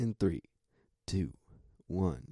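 normal talking voice

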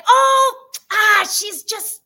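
high, loud brush-off cry of oh; dismissive mom. Retold or imitated. Mom shrugging off the aunt, loud and high. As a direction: mom waving it off at full volume. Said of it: "And my mom's like".

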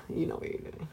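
a breathy, voiceless whisper. Shown as a demonstration. Friend repeating the point to her friend, whispered. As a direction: lean in and whisper it knowingly. Repeats the line, then hushes it.

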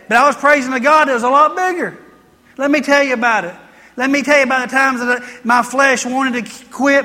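ordinary delivery

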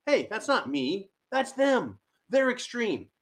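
ordinary talk, nothing marked

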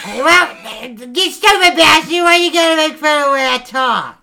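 loud high mock anger; laughing outrage. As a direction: yell back at the mocking. Angry at being mocked.

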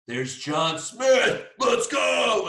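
loud, drawn-out sports cheer; excited holler. Retold or imitated. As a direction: build to a high, shouted cheer. Retold hollering on spotting buddy.

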